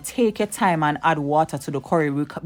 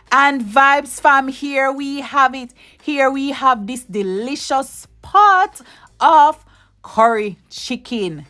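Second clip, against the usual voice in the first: higher, slow showcase voice, savouring each word; grand reveal; 6 semitones higher, slower than usual. Acted. Cook unveils finished curry chicken to her Vibes Fam, slow grand reveal. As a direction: unveil the dish like a presenter.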